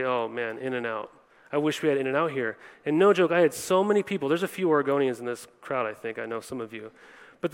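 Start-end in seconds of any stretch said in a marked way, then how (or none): 0.0-2.6 low, soft retold longing; a touch of wistfulness, voice dropped low; mock wistful. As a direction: lightly act out missing a favorite place. Re-enacts missing a favorite burger place.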